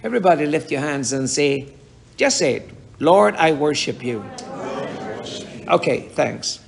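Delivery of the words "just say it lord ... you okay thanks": ordinary delivery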